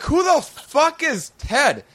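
can't believe what you heard; incredulous. Retold or imitated. Baffled retort to the friend.

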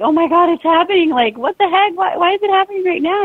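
very high mock-panicked squeal; retold freak-out. Mother recalling first contraction, high-pitched freaking out. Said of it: "kinda freaking out".

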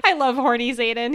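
ordinary talk, nothing marked

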